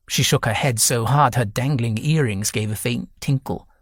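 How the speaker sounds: ordinary delivery, nothing marked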